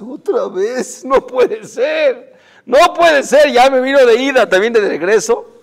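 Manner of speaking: loud, high, exasperated outburst; angry disbelief. Acted. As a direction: rage at the bad luck, slow at first. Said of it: "el coraje de este hombre".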